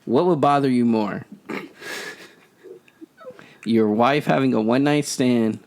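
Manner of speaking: ordinary talk, nothing marked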